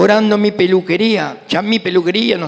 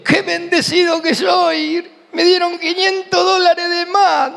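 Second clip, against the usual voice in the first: much higher, slow, tearful hammed-up joy; 12 semitones higher than usual. Believer bragging about extra bank money, mock tearful elation. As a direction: gush like a smug blessed believer. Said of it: "Y un cristiano dice".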